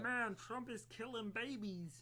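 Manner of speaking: mock alarm of a casual reader; imitating gullible readers. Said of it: "They just kinda like".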